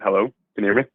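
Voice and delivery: ordinary talk, nothing marked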